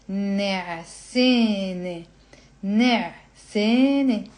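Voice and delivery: "نعسانة" stretched syllable by syllable; slow teaching pace. Shown as a demonstration. Teacher showing the feminine form to learners, slow. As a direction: sound out "نعسانة" slowly. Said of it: "we're reading it slowly and we're explaining".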